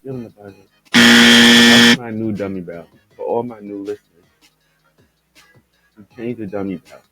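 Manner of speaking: normal talking voice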